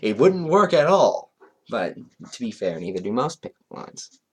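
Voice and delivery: usual speaking voice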